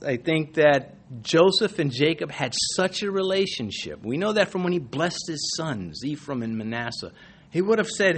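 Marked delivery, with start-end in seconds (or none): none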